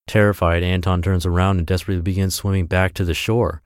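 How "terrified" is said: usual speaking voice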